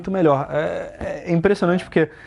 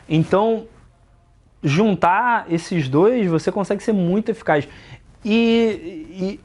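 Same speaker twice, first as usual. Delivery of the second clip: ordinary talk, nothing marked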